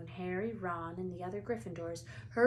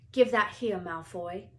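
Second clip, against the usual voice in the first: ordinary delivery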